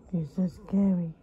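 normal talking voice

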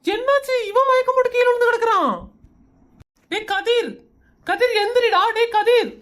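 high-pitched, alarmed calling. Acted. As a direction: cry out in alarm, trying to rouse a fainted friend. Finds friend fainted, calls him. (பதற்றத்துடன் உயர்ந்த குரலில் அழைப்பு)

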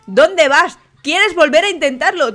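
normal talking voice

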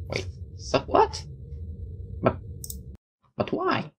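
very high, hushed disbelief; baffled squeak. Real, not acted. Player baffled by the game's story, small high squeak. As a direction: squeak it softly, in halting bewilderment.

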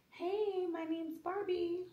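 high, soft doll voice; Barbie. Pretend. Mom playing dolls with child, high Barbie voice. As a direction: lift it into a sweet little doll's pitch. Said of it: "Mommy can't do that voice".

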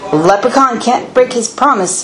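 plain reading voice

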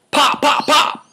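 high, punchy mock gunshots, pop pop pop; popping gunshot imitation; gunshot noises. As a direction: spit out gun pops like a kid playing war.

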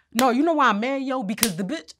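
retold angry rant. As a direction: play her fuming outburst. Kim venting her anger retold.

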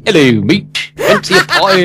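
exaggerated high cockney-ish Brit voice; hammed-up American take on English; mock English accent. Guest mocking how Americans fake English accents. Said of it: "It usually sounds".

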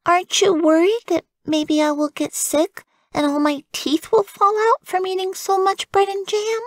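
put-on high, childlike worry; worried little girl. Frances asks her mother, high and anxious. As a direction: play it up as anxious.

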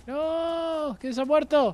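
very high, drawn-out "no" wail; wailing dismay. Real, not acted. Player laments a fallen teammate, high dramatic wail. Repeats dismay, much higher.

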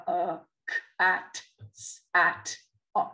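sounds said one by one with gaps, "k", "at", "s"; choppy separate sounds. Shown as a demonstration. Teacher showing parents how we don't speak, sound by sound. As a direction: break the word into isolated sounds. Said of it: "We don't speak like that".

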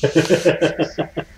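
drawn out through laughter; slow words broken by laughs; laughing. Real, not acted. Laughing at her teasing.

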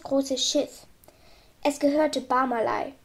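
plain reading voice

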